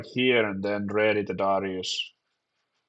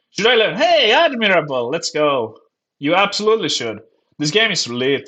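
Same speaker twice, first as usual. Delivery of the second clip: loud, high hype-man shout; excited hype; 6 semitones higher, 5 dB louder than usual. Real, not acted. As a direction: cheer the card on loudly. Hyping an ally card.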